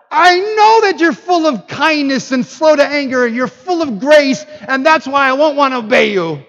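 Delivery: loud, high-pitched angry complaint; hammed-up defiant outburst at God; Jonah. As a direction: draw out the indignation, stubbornly. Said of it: "Jonah was angry that the Ninevites".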